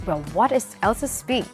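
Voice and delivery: ordinary delivery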